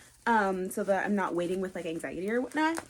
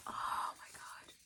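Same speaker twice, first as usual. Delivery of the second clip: soft stunned whisper; awed whisper; 12 dB quieter, slower than usual. Real, not acted. Stunned by the book, whispering in awe. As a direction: barely breathe it.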